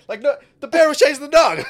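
high, loud laughing exclaim; excited. Real, not acted. Friend laughing about the bear chasing the dog, excited. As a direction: yelp it out in glee.

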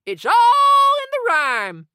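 loud, slow, drawn-out declaration; emphatic. Acted. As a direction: proclaim it grandly. Teacher stressing a magic rule.

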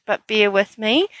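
normal talking voice

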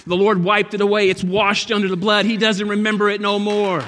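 loud, high preaching climax; full-force preaching. Acted. Pastor preaching hard to the church. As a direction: preach it out, building to a shout.

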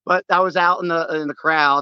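usual speaking voice

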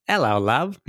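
imitating British greeting. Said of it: "he would just go".

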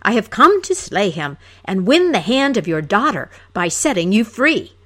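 loud, pompous proclamation; blustering loud boast; roaring hero. Acted. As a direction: trumpet the claim to the princess's hand. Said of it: "roared he".

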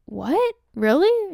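high, loud incredulous questions; stunned disbelief. Retold or imitated. Attendee stunned by stranger giving up her photo turn, incredulous. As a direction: blurt it out, pitch leaping in disbelief. Stunned reply to a generous offer.

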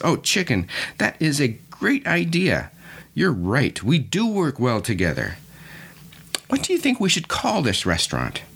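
high-pitched, excited storybook pig. Acted. Pig replies to chicken's restaurant idea, excited. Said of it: "he gets all excited and he says".

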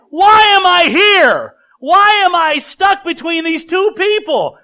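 high, loud, slow angry whining; venting complaint. Acted. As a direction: cry out, stuck and fed up. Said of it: "I would vent".

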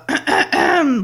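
loud, stalling throat clear; exaggerated throat clearing. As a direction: clear the throat in mock reluctance. Acting Francine's reluctant answer.

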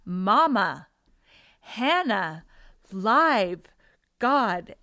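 put-on loud, punched toddler words with pauses between; insistent child. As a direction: hammer each word like a child demanding to be heard. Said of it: "I heard the voice from behind again".

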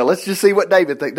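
usual speaking voice

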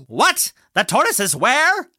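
high, incredulous outburst; shocked disbelief. A narrator's reading. Hare wakes to find Tortoise gone ahead, high shocked questioning. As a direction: shoot the questions up in pitch, aghast.

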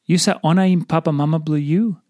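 usual speaking voice

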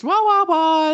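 high whiny dog wah-wahs; whining dogs. Pretend. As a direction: whine like cold unhappy dogs. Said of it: "You can almost hear 'em say it in their voice".

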